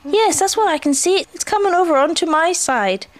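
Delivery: high, eager character voice; Pooh spotting a stick. A narrator's reading. Pooh tells Piglet his stick is coming, eagerly. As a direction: pipe up with growing interest.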